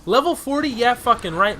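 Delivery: incredulous. Real, not acted. Incredulous at boss level.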